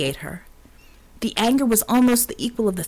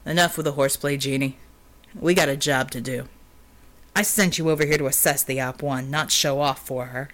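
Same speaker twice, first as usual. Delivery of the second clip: lower, stern and unamused; deep authoritative voice; 6 semitones lower than usual. A narrator's reading. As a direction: command like a weary boss. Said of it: "deep, authoritative, unamused".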